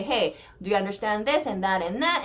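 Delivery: ordinary talk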